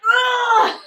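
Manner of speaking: high, loud re-enacted yell; shouting. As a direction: let out a shout on command. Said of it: "Shout it".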